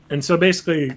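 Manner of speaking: normal talking voice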